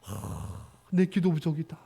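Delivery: soft sigh, then a quiet, low confession; humble sigh. Retold or imitated. Wife sighing quietly, blaming her own prayer, soft and low. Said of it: "아내가".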